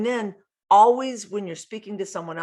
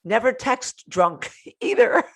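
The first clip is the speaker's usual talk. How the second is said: laughing; 7 semitones higher, 6 dB louder, slower than usual. Real, not acted. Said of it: "laughs while saying".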